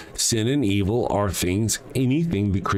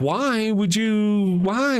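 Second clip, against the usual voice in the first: mock pleading victim; 11 semitones higher, much slower than usual. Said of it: "you're like".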